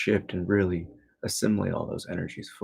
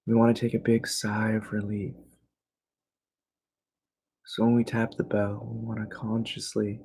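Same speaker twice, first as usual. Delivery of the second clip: guided meditation. Real, not acted. Teacher guiding listeners into evening relaxation, calm meditation voice. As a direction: ease them gently into stillness.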